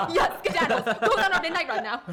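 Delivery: high, loud, laughing mockery. Real, not acted. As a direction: tease him while laughing.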